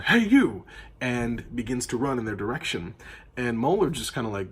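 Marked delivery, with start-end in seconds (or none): 0.0-0.6 loud, high shout at the suspects; guard yells at the pair from forty feet away, loud shout. Acted. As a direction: bark the challenge across the street. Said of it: "one of the guard yells out".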